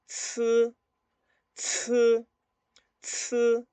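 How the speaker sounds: "zi" as a ts spat out with a burst of air. Shown as a demonstration. Teacher contrasting C with Z, "zi" as strongly aspirated ts. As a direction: spit air through the ts of "zi", high and level. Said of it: "Ahora escupo aire".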